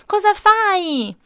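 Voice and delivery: high, loud, slow alarmed call; mother shouts at her son climbing the tree, high and slow. A narrator's reading. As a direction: call out in shock to your child. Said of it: "Sentii gridare la voce della mamma".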